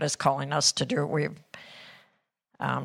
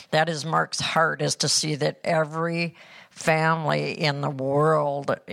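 ordinary delivery

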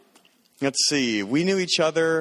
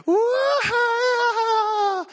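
a shrill, much higher whooping cry-laugh; imitated ecstatic scream; 3 dB louder than usual. Retelling the night he heard Leah cry out in laughter, a high whooping yell. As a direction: mimic a sudden holy-laughter scream. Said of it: "this scream".

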